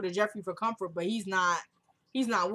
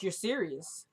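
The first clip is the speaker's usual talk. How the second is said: hushed disbelief; 2 semitones lower, 6 dB quieter, slower than usual. Retold or imitated. Retold disbelief, first take.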